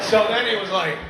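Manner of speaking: ordinary talk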